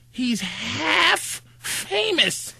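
drawn-out slow announcement. Retold or imitated. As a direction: stretch every word out. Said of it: "she introduced me as".

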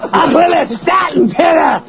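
high, hammed-up stand-up shouting; Chris Rock. Host confessing in the comic's style, Chris Rock impression. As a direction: rant in a comedian's high holler. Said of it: "That's Chris Rock".

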